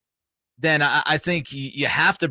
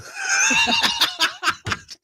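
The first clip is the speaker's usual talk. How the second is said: high-pitched burst of laughter; laughing. Real, not acted. Host cracks up at his tired co-host, laughing.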